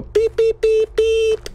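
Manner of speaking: high, steady vehicle beeps; high-pitched mock beeping; imitated horn beeps. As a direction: honk like a little car horn.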